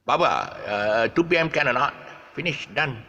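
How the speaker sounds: clipped Malaysian Manglish phrasing. Shown as a demonstration. Customer booking a haircut in Malaysian Manglish. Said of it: "all you have to say is".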